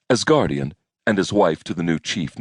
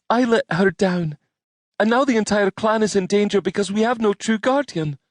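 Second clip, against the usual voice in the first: put-on distressed young woman voice. Jeanette blaming herself.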